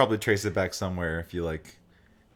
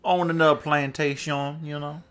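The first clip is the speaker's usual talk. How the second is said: Savannah accent; 5 semitones higher than usual. Shown as a demonstration. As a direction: slip into a Georgia Southern accent. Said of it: "Just give him the Savannah".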